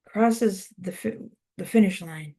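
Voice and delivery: usual speaking voice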